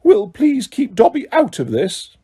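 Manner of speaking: a frightened elf mumbling a plea; Dobby nervously begging Hermione to leave him out. A narrator's reading. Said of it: "Dobby mumbled".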